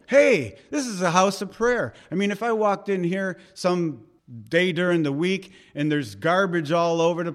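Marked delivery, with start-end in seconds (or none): 0.1-1.9 loud, higher-pitched indignant call; zealous rebuke. Acted. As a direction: call out in acted zeal, hardest on the first word. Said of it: "I think that's zeal".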